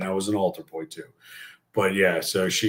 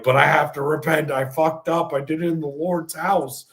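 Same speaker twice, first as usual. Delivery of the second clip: pleading; 7 semitones higher than usual. Retold or imitated. Joe pleading with Monsignor at confession, higher. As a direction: beg like a guilty kid. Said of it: "And I'm like".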